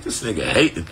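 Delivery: low, muttered aside; a low private grumble about the kid hating; inner grumble. Retold or imitated. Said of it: "I was like".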